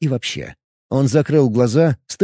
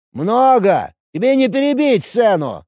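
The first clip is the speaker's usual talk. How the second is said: shouting priest; 9 semitones higher than usual. Acted. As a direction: bark the order down at a cornered enemy. Dark priest gloats.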